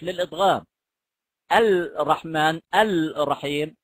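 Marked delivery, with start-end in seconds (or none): 1.5-3.8 slow الرحمن and الرحيم with the L merged into a doubled full R; assimilated R. Shown as a demonstration. Teacher demonstrating Quranic R assimilation to students, slow and deliberate. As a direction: draw out الرحمن and الرحيم as ar-raḥmān, ar-raḥīm, the R heavy and doubled. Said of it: "ومدغمة بتصير الرحمن الرحيم".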